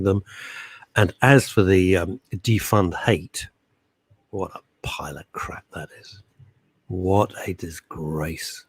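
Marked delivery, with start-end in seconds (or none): 4.3-6.2 soft, unvoiced scornful whisper; whispered aside. Real, not acted. Host dismissing a campaign in a contemptuous whisper. 6.9-8.6 slow, softer disgusted line; quiet disgust. Real, not acted. Host condemning a campaign, slow and quiet.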